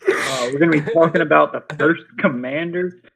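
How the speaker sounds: loud, hyped streamer intro; pumped-up host voice; Vex impression. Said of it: "That was a good Vex impression".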